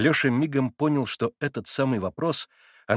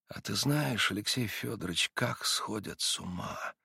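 much softer, slow and curious; sudden quiet; 8 dB quieter than usual. A narrator's reading. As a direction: drop to a hushed, simple question. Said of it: "совсем вдруг тихим". (тихо, с простодушным любопытством)

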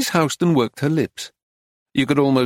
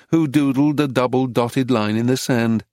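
ordinary delivery, nothing marked

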